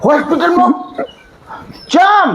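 high startled question; shocked. Acted. Gabhaji hearing the wife is in hospital, shocked. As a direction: jolt up in disbelief.

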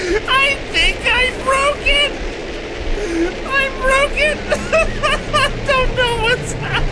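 excited squeal. Real, not acted. Gleeful panic at broken game.

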